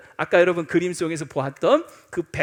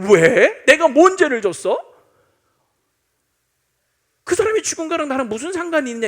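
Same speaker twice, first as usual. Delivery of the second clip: indignant skeptic; 4 semitones higher, 8 dB louder than usual. Acted. Skeptic protesting why.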